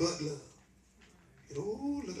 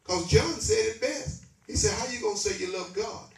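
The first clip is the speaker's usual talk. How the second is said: higher, louder preacher's call to the congregation; hammering the message with rising fervour; preaching at full force; 4 semitones higher, 3 dB louder than usual. Acted. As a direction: drive the sermon home to the pews.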